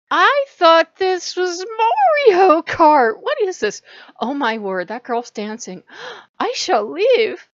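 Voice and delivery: high, loud flustered outrage; hammed-up horrified exclaiming; mock shock. Acting out flustered exit from club.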